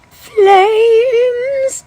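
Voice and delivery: high shrieked cry of fury; the woman from Clue. Retold or imitated. Mom turns into the Clue woman at her son, shrieking fury. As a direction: screech it like the furious Clue woman. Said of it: "I turn into the woman from Clue".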